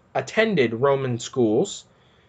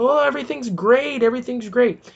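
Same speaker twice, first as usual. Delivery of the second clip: mock cheery voice, pitched much higher; mocking quote; 9 semitones higher than usual. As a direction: ham up their fake reassurance. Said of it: "they keep telling".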